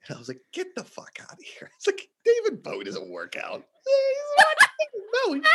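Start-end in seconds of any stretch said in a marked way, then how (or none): 0.5-1.7 whispered disbelief; stunned whisper. Retold or imitated. Disbelief at [name] news. 2.2-3.6 disbelieving whisper. Retold or imitated. Disbelief at [name] news. 3.8-5.5 high, loud laughing disbelief; laughing shout. Real, not acted.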